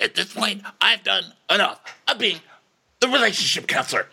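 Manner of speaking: high, mock self-important voice; Austin. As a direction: announce it in hammed-up exasperation. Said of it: "And Austin's like".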